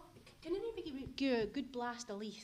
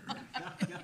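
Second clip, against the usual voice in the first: laugh; 3 semitones lower, 7 dB louder than usual. Real, not acted. Laughing at Jimmy's denial.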